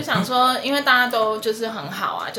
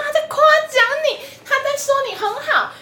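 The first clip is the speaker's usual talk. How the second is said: high, insistent, a bit louder; exasperated explaining; 5 dB louder than usual. Retold or imitated. Telling her boyfriend the friend was praising him, exasperated and high.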